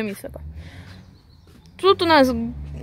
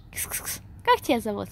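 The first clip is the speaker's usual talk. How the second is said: cat-calling sounds; 4 semitones lower, 7 dB quieter than usual. Real, not acted.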